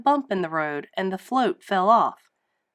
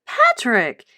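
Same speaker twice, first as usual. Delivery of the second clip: high, loud cartoon sponge voice, slowed; exasperated SpongeBob; 7 semitones higher, 5 dB louder than usual. A narrator's reading. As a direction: correct him with a squeaky huff. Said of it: "said SpongeBob".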